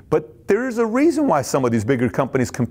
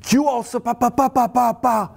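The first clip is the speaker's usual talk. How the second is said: high mocking blah-blah excuses; high mock excuse-making babble; defensive excuses; 9 semitones higher than usual. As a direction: parody a defensive rambling rebuttal. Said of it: "rather than".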